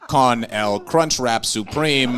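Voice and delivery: loud, slow, clumsy tourist Spanish; a lost gringo trying his few words of Spanish, loudly; bad Spanish. Tourist with broken glasses asking strangers in Mexico, loud broken Spanish. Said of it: "in Mexico and I didn't know Spanish".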